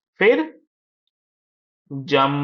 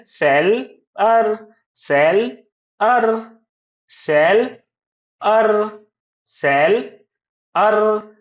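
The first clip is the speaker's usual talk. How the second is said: सेल, pause, higher अर, both punched; split into syllables; 5 semitones higher, 4 dB louder, much slower than usual. Shown as a demonstration. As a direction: chant सेल and अर as separate beats. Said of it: "seller कैसे बनता है?".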